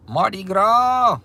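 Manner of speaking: high, loud, drawn-out festive cheer; excited holler. Real, not acted. Louisiana streamer cheering for Mardi Gras, excited holler.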